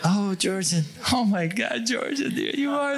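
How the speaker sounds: high, thrilled gushing over a favorite drummer; starstruck fan. Retold or imitated. Passport boss recognizing his rock drummer, high excited gushing. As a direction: gush like a fan meeting his idol. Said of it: "So he goes".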